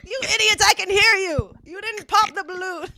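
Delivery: playful irritated yell; exasperated mock scolding. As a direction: scold them like fools, hammed up. Acting [name] scolding the callers.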